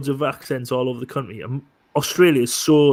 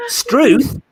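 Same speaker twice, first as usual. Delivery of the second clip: mock Australian; 4 dB louder, slower than usual. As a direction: bark it like an outback bloke. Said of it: "the Australian accent".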